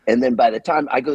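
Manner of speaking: ordinary talk, nothing marked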